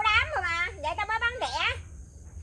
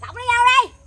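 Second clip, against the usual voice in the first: shouted order; 7 semitones higher, 10 dB louder, slower than usual. Acted. Ordering others to help.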